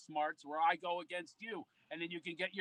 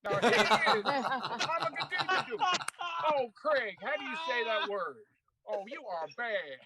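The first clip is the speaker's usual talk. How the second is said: Magic Johnson impression; 4 semitones higher, 3 dB louder than usual. Retelling the co-host days, imitating Magic as host. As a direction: stretch the word like a stumbling host. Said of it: "Magic was reading a cue card going".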